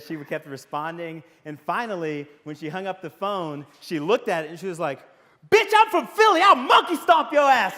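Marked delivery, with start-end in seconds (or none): 5.5-7.8 high, loud furious outburst; pissed-off wife. Retold or imitated. Talk speaker imitating his wife after an infuriating phone call, pissed-off yell. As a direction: yell it like a brash hometown threat. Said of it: "like, pissed off".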